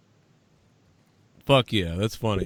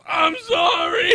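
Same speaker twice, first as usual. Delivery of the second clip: high, slow, crying-laugh "I'm sorry"; imitating the beaten man's apology through laughter; mock whimpered apology; 12 semitones higher, slower than usual. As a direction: squeak it out like a beaten man. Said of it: "And the guy goes".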